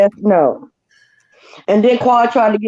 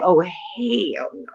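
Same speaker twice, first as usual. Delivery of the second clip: slow disgusted; 3 semitones lower, 8 dB quieter, much slower than usual. Retold or imitated. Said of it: "I'd have been like".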